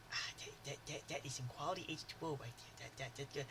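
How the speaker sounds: put-on whisper-mumble it like the Water Boy; Water Boy impression. Tasting the salesman's water, quoting the Water Boy softly and fast. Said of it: "I remember the line".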